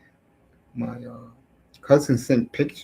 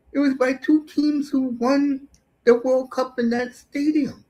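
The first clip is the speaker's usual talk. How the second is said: high, louder jeering brush-off; mocking taunt; 11 semitones higher, 3 dB louder than usual. Taunting Mexican fans over their stadium record, high jeering scorn. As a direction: needle the rival fans, pitched way up.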